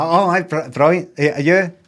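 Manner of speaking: a hammed-up breezy answer; mock cheery reply. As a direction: brush it off with forced brightness.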